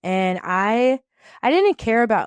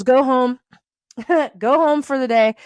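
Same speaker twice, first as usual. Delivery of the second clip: raised, higher voice quoting the boss; retold order to leave; 5 semitones higher, 6 dB louder than usual. Boss orders her home for the day, loud and high.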